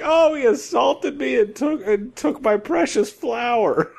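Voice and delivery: high-pitched, hammed-up lament; mock victim. Faking an attack after punching yourself, mock victim lament. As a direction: wail it as a badly faked victim.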